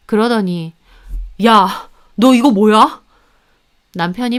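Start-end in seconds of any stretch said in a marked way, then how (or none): 1.4-3.0 slow, loud accusing demand; stern, slowed-down challenge; angry confrontation. Acted. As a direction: demand an explanation, heavily. Husband confronts sister with photos.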